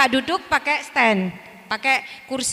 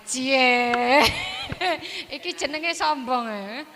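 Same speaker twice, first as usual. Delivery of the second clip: lightly voiced teasing puppet; 2 semitones lower, much slower than usual. Puppet teasing its puppeteer, lightly voiced. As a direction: tease the teacher cheekily. Puppet teases the teacher in Javanese.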